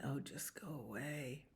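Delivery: hushed, low murmur; possum's private thought. Acted. Possum playing dead wishes them gone, hushed weary thought. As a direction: think it aloud almost in a whisper. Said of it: "he's thinking".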